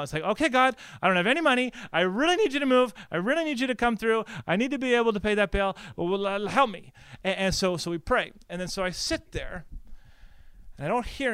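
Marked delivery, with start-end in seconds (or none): 0.2-6.9 high, loud panicked mock prayer; acted frantic begging; freaking out. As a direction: plead urgently with God, louder and higher. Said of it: "you're praying and you're freaking out".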